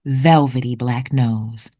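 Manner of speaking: ordinary delivery, nothing marked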